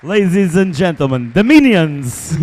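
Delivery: booming stage introduction, the last words stretched out; grand announcer. Acted. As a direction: present them like a ringmaster.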